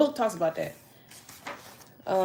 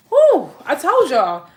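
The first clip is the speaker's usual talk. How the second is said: excited exclaim; 6 semitones higher, 8 dB louder, slower than usual. Real, not acted. Excited about the book.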